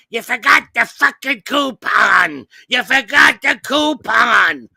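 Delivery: mother screaming on the phone about the coupon. Retold or imitated. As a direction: yell it down the phone like an angry mom. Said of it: "She's screaming on the phone".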